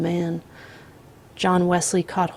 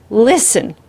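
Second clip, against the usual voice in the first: loud, urgent almost-scream; nearly screaming, to get his attention; 4 semitones higher, 9 dB louder than usual. A narrator's reading. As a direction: grandmother pleading with the killer, almost screaming. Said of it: "the grandmother almost screamed".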